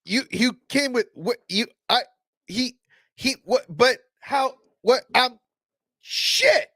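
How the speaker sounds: hammed-up spluttering shock; stunned disbelief. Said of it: "it's like, what is it?".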